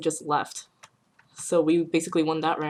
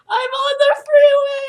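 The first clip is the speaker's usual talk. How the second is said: much higher and louder, frantic; panicked wail; 11 dB louder than usual. Retold or imitated. New driver panicking on the freeway, high mock wail. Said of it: "She's like".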